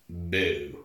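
low, soft oozed-out boo; secreted, not spoken. Retold or imitated. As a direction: let the word seep out quietly, imitating a vampire. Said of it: "He secretes it. Instead of talking".

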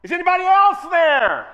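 high, loud comic holler. Acted. Hanging man yelling into the dark for another rescuer. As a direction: shout it out, hoping for someone else. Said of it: "he hollers out".

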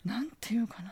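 hushed, breathless aside to herself; whispered thought. Real, not acted. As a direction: whisper it like a secret doubt. Inner thought, unsure what to answer.

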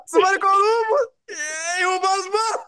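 high-pitched comic holler; driver calling out. Acted. Voicing Uber driver calling out.

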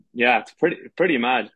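normal talking voice

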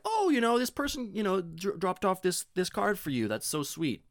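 high, sugary put-on voice; sugary high voice for mom; mock sweet voice. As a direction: play it overly sweet and chirpy. Imitating own sweet tone to mom.

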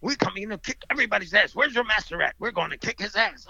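a touch of movie-villain bravado; aggressive movie fighters. Retold or imitated. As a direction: lightly imitate a challenger picking a fight. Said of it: "where they always have aggressive guys".

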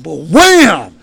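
loud, slow boom; impact words. Retold or imitated. Storyteller acts out hitting the water, loud and slow. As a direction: slam the words down.

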